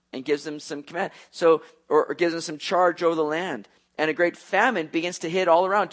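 normal talking voice

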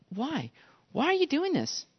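soft, high-pitched bewilderment; puzzled soldier. Acted. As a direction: ask softly, voice rising in disbelief. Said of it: "the guy's gonna keep walking and go".

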